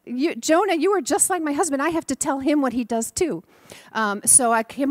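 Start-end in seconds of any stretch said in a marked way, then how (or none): 0.0-3.4 high, loud, laughing outburst; amused exasperation. Real, not acted. Minister joking that the boy is like her husband. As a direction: burst out, amused and fed up.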